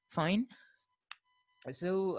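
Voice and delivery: ordinary talk, nothing marked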